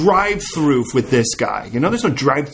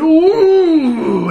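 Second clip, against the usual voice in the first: long, loud high "ooh" wail; hammed-up prayer howl; fervent wail; 12 semitones higher, 7 dB louder than usual. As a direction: cry out high and hold it. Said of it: "he was a fervent prayer".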